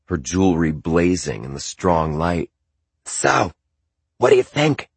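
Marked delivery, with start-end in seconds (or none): 3.0-4.9 Zandra's voice. Acted. Zandra asks narrator.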